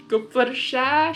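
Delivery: plain reading voice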